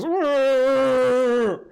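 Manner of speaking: loud drawn-out creature moan; wordless moan from a hobbling creature; Mickey's creature noise. Acted. Hobbling Mickey greets the party, long loud creature moan. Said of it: "Mickey, like, hobbles over ... and goes".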